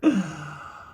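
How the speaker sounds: short high burst of laughter; real laugh. Real, not acted. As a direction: laugh after your own punchline. Laughing after the story's punchline.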